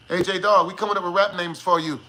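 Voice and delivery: ordinary delivery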